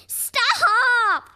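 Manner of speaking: high, loud frantic stop; chased captain yelling frantically. Acted. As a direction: scream it in panic. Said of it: "Captain Blackbeard yelled frantically".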